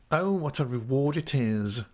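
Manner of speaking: lightly voiced oily clerk; a hint of a fawning, humble clerk; humble Uriah. A narrator's reading.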